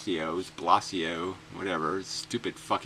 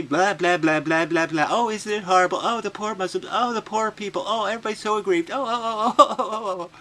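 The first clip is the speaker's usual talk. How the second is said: higher, fake wailing pity; mock sympathy; 10 semitones higher, 4 dB louder than usual. Mocking the official's fake grief in a high whine. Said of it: "and I just say".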